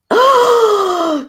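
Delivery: a sharp high gasp of alarm; shocked gasp. Retold or imitated. A mother hearing the price lets out a sharp, high shocked gasp.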